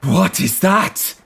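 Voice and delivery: high, slow puzzled call; man asking. Acted. A man in the crowd pointing at Gorm, high puzzled call. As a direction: stare and question. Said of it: "says one man".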